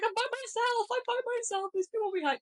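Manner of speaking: very high panicked squeal; frantic high-pitched wail, acted for the story; retold panic. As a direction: relive being abandoned on a scare ride. Retelling panicked run, left behind.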